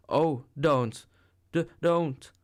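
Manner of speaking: frightened pleading, voice shaken; tremulous protest. A narrator's reading. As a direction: beg him to stop while being shaken. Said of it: "she protested in a voice made grotesquely tremulous".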